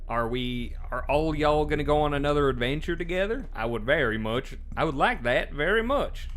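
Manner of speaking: put-on southern accent. Asking characters in a hammed Southern accent. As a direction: lay the Southern accent on thick. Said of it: "the southern accent".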